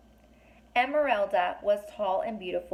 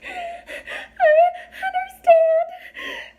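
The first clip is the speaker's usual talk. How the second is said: high sobbing wail; crying fairy, high and loud; through sobs; 9 dB louder, much slower than usual. A narrator's reading. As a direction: sob the words out. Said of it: "she said through her sobs".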